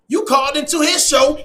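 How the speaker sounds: normal talking voice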